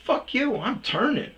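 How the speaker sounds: high, loud angry shout; retold furious shout; retold yell. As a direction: yell it out the car window. Leaning out window yelling.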